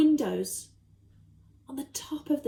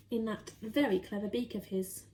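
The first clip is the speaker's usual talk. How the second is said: ordinary delivery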